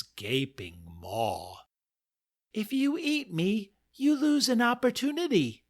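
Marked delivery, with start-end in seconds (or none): 2.5-5.6 high squeaky mouse voice, quick in the middle; tiny creature bargaining for her life. Acted. Mouse pleading with the lion above his maw, high squeak. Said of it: "said the mouse".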